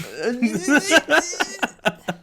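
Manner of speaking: loud, high cackle; high burst of laughter. Real, not acted. Friend laughing at his own England joke, loud high laugh.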